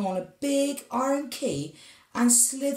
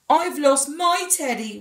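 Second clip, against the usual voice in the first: higher, louder wail of loss; wailing giant; 6 semitones higher, 6 dB louder than usual. A narrator's reading. Giant wails to Tom about his lost teddy. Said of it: "wailed the giant".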